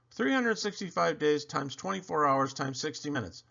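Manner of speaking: plain reading voice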